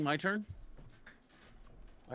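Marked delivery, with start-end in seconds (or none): 0.0-0.4 mild mock yell of "My turn"; a hint of a whiny shout, rising. Suggesting a cartoon shout to a friend, mild mock yell. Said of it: "Have you tried yelling".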